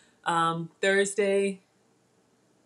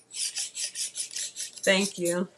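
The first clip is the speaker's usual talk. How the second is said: choked thanks; 5 dB quieter than usual. Real, not acted. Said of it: "I don't know what to say".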